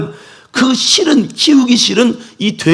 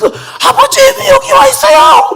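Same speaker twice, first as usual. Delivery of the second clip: high, loud, choked call to father; tearful cry; 4 dB louder, slower than usual. Acted. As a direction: cry out to him, overwhelmed. Son's tearful reunion cry.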